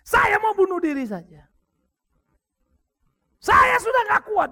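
high, loud and slow outcry; desperate cry. Retold or imitated. Pastor voicing the wife's suicidal cry, high desperate shout. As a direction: plead at breaking point.

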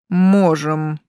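grudging one-word assent, a bit strained; grudging, forced out. A narrator's reading. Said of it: "процедил граф". (нехотя процедить)

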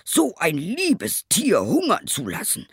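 high, indignant tailor's outburst; angry cry. A narrator's reading. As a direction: cry out in outrage at the cruelty. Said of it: "schrie der Schneider". (empörter Aufschrei)

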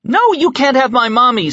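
put-on a small girl shrieking for her mother; screaming little girl. A narrator's reading. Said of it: "screamed Mina".

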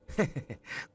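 short light laugh; a quick dismissive chuckle; bật cười. A narrator's reading. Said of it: "Tony bật cười". (Tony cười xòa để lảng tránh câu hỏi)

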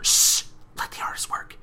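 sharp shush, then whisper; hushed shushing. Retold or imitated. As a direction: shush and whisper to protect the artist. Pinky shushes Twilight.

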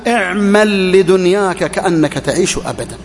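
slow weighty recital of the saying; slow, deliberate quote. Shown as a demonstration. Preacher citing the saying to the congregation, slow and weighty. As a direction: lay out اعمل لدنياك كأنك تعيش أبدًا slowly, word by word. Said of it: "البعض يحفظون".

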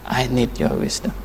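usual speaking voice

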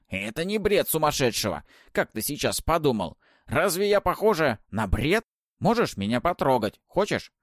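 высокий громкий насмешливый старушечий голос; поддразнивай, как хитрая ведьма; голос Бабы-Яги. Acted. Баба-Яга дразнит гостя. (насмешливый голос ведьмы)

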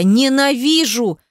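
high, drawn-out anguished cry; through tears. A narrator's reading. Heroine in tears to Demyan, anguished hatred. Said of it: "процедила сквозь слёзы".

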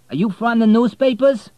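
high, loud, chatty cabbie; cab driver voice. Acted. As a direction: voice the talkative driver.

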